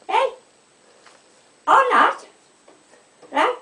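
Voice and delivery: sudden high loud exclaim; high indignant burst; indignant outburst. Real, not acted. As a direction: throw it out, fed up.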